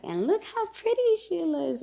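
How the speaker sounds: mock proud squeal over how pretty; imitated gushing. Wedding helper shows off the bride.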